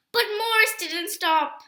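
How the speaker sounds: usual speaking voice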